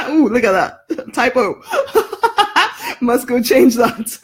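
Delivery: high, giggling words with bursts of laughter; laughing at a typo. Real, not acted. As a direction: crack up at your own mistake. Spots a typo on her page.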